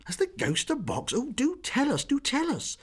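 high, loud pleading; eager begging. Acted. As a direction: beg her eagerly. Girls beg for the secret.

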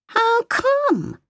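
put-on high, slow small-child voice; a curious little girl asking; little girl's voice. A narrator's reading. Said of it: "the child asked".

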